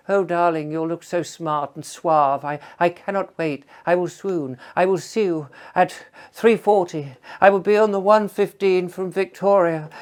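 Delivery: lightly hammed-up posh lady; swooning lady. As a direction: gush like a lovestruck lady on the phone. Voicing the posh woman caller.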